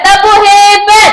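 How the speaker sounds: high, loud classroom chant call; cheer call. Shown as a demonstration. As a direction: launch the class into a clapping cheer. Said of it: "Kita beri tepuk hebat".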